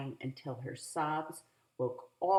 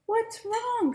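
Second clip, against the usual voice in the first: put-on high, loud worried kid; worried big brother; 7 dB louder than usual. A narrator's reading. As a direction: ask what happened. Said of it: "said Daniel".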